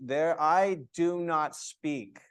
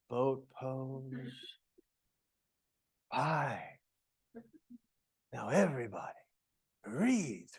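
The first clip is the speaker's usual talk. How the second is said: boat pose called much softer, no push behind it; sleepy teacher giving a core count; low-energy cue; 3 semitones lower, 7 dB quieter, slower than usual. Shown as a demonstration. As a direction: let the count fade out with no drive. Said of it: "you can't be like".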